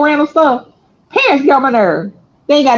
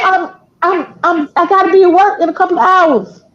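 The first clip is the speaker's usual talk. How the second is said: exasperated pleading; 6 semitones higher than usual. Retold or imitated. Retold pleading on early phone call.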